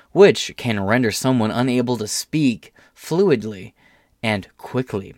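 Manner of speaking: ordinary delivery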